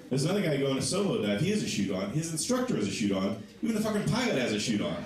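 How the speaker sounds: ordinary delivery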